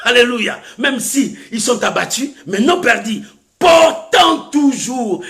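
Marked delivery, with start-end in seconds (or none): 3.6-5.2 slow, drawn-out, high proclamation; ringing preacher's reading; declaimed scripture. Real, not acted. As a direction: stretch each word out emphatically.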